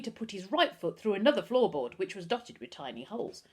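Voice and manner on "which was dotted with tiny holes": plain reading voice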